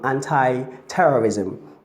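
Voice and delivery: ANTI-TERRORISM said slowly as "AN-tie-terrorism"; American-style anti. Retold or imitated. Teacher imitating broadcasters on the news, American-style anti. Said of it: "our broadcasters say".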